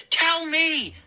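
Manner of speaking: high, forceful order; insistent demand. Acted. Moose pinning the boy down, insistent. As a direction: demand it hard, pitch well up. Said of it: "Moose insisted".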